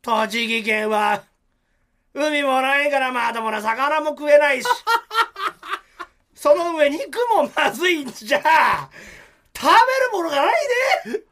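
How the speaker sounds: high, loud, slow sneering; sarcastic. Retold or imitated. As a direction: drip sarcasm, slowly and loudly. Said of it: "本当に嫌味っぽく言ってきたのね".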